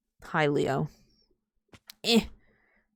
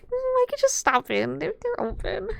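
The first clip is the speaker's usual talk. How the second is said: much higher, coaxing and sly; high sing-song tempted voice. Retold or imitated. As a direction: tease yourself into it. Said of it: "I'm like".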